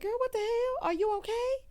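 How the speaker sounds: voiced high and fretful; high worried quote. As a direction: play Andy fussing over her.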